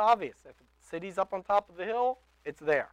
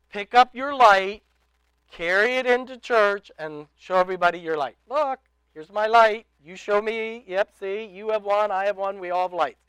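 mock cheerful show-off; 3 semitones higher, 13 dB louder than usual. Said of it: "He didn't say".

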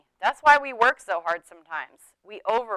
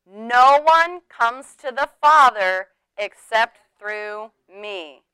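slow, measured recitation; slow, solemn unison reading; congregational recitation; 4 dB louder, slower than usual. Real, not acted. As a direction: lead the room in reciting scripture. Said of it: "say it with me".

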